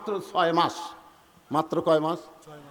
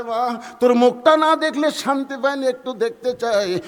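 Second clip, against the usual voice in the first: father's plea; 5 semitones higher, 6 dB louder than usual. Acted. Father begging to see his daughter's face, raised plea. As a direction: plead earnestly, lifting the pitch.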